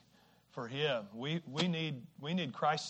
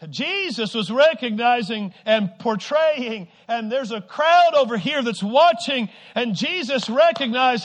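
loud, rousing sermon delivery; full-force preaching; 7 semitones higher, 14 dB louder than usual. Real, not acted. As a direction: preach it out to the whole congregation.

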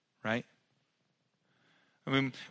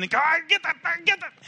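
much higher and louder mock shout; mock yell in the van; 7 dB louder than usual. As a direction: yell like a frazzled parent driving.

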